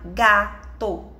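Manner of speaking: "gato" in two parts, ga and to; syllable by syllable. Shown as a demonstration. Teacher counting mouth openings with a child, split slowly. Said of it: "abre a boca pra falar".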